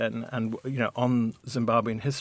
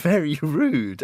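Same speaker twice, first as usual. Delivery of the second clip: ordinary delivery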